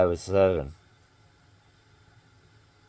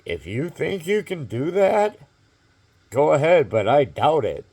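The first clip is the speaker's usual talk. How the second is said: high, louder mocking voice of a doubtful man; mocking doubter; 8 semitones higher, 7 dB louder than usual. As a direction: sneer it like a man who doubts you. Retold doubting challenge from man.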